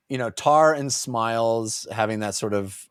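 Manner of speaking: ordinary talk, nothing marked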